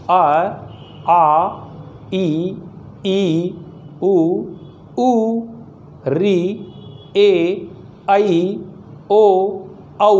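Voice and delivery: each vowel अ आ इ ई sounded apart with pauses; clear vowel recitation. Shown as a demonstration. Teacher models pure vowel sounds for children, slow and distinct. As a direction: say each vowel slowly, lips shaping it. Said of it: "यानी आप बोलेंगे कैसे".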